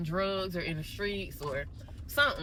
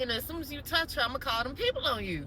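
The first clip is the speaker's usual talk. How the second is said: threat; 7 semitones higher, faster than usual. Retold or imitated. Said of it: "And I was like".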